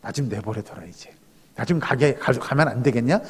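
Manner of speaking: low, softened voice of God; God speaking casually. Acted. God asking the clinging believer to let go, low and casual. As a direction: play God quietly asking to be released, pitched well down. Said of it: "하나님께서 여러분들한테 그렇게 이야기하는 거죠".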